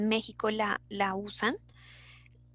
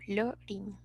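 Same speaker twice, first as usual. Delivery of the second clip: "Lorin" as LO-rin, stressed on the first syllable; "Lorin" with accent on O, falling away softly; 2 semitones lower, 9 dB quieter than usual. Shown as a demonstration. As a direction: softly demonstrate "Lorin", leaning on the O. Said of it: "con acento en la O".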